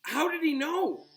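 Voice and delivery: put-on astonished girl's voice; stunned. A narrator's reading. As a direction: gasp it out in disbelief. Hermione stunned by the tree.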